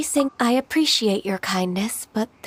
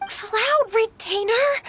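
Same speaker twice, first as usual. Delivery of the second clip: embarrassed plea; 12 semitones higher, much slower than usual. Acted. Ganyu begs her to stop.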